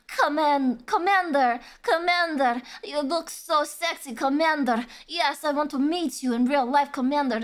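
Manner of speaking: sultry mock Russian accent; Russian woman accent. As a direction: imitate a Russian voice actress from a cheesy ad. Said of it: "this busty Russian woman".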